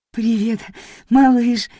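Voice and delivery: зубы стучат от холода; громко, стуча зубами от холода. Acted. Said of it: "стуча зубами". (стуча зубами от мороза)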